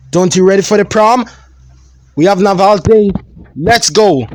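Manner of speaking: high, loud, unsettling cheer; murderous cousin eager to leave for prom; creepy eager killer. Acted. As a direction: sound too bright for a murderer. Killer Ben urges prom.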